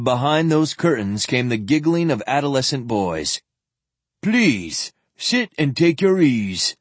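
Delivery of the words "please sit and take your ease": expansive. Acted. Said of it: "Ereshkigal invited expansively".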